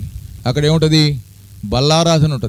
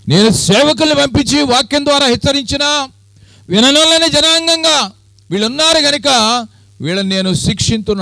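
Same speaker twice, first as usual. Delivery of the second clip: voice of God; 11 semitones higher than usual. Acted. Preacher voicing God condemning a deaf people. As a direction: proclaim it as God who was ignored. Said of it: "అంటున్నాడు దేవుడు".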